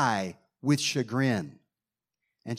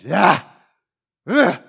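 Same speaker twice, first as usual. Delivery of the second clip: angry groan; 2 semitones higher, 9 dB louder than usual. Shown as a demonstration. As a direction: groan out fed-up anger. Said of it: "it was kinda like this".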